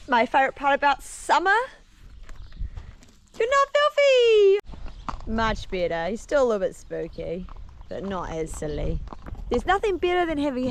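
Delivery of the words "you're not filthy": delighted praise. Real, not acted. Praising clean horse.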